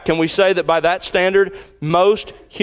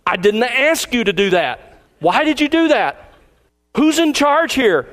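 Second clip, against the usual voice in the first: much higher, scolding the computer in play; mock-angry pastor scolding his computer; mock exasperation; 6 semitones higher than usual. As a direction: berate the stubborn computer, hammed-up. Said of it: "I'm talking to my computer".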